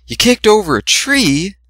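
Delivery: high shocked question; gasping surprise. A narrator's reading. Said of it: "Fluttershy gasped".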